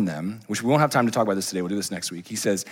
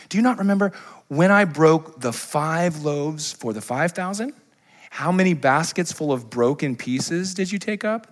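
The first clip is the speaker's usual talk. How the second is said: slow probing question; 5 semitones higher, slower than usual. Retold or imitated. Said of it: "Look what he said".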